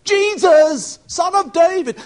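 high, loud desperate cry; almost screaming. Shown as a demonstration. Blind beggar cries after Jesus, almost screaming. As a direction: strain the voice up into a near scream. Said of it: "means almost to scream".

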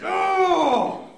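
high, loud, held shout; hammed-up yell of a woman in labour; Welsh labour bellow. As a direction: let out a long roaring "oh". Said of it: "the kind of noise that comes out".

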